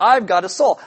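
ordinary delivery

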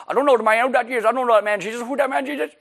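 high-pitched, hammed-up hillbilly; hillbilly accent. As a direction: play a comic hillbilly denying it, pitched high. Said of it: "That's my hillbilly".

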